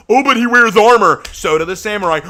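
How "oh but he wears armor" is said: high, loud mimicry of a silly argument; mocking objector. Player mimicking those who defend the Spartan as knight, mocking. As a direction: parrot the objection in a raised, mocking voice.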